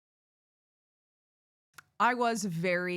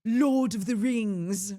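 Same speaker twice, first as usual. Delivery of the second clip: slow, louder Lord of the Rings, English lawd without r; 4 semitones higher, 7 dB louder, slower than usual. Shown as a demonstration. Imitating English people saying Lord of the Rings, English accent. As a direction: draw it out in a posh English way. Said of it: "I think they're saying".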